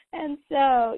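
high laughing gasp; real laughter. Real, not acted. Host laughing hard at the memory, high. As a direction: laugh until it nearly cries. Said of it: "laughter and crying sound".

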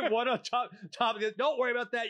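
high, loud, laughing mock reassurance; a sloppy contractor laughing off the crooked trim, high and loud. Narrator mimics contractor shrugging off mistakes, mock high voice.

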